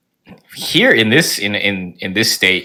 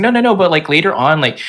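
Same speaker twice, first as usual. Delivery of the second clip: voiced student; 5 semitones higher than usual. The student reassures him, in a high put-on voice. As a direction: play her reassuring him, pitched up.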